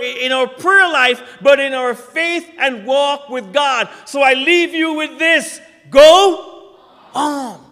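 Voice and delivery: loud, high exhortation to the pews; rally them to turn and speak; preaching call. Acted. Preacher urging the congregation to tell their neighbour, loudly. Sermon close, full force.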